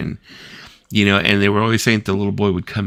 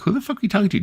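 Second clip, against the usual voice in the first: much higher, disbelieving demand; incredulous question; much faster than usual. Retold or imitated. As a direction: ask in shocked disbelief. Asking friend next morning.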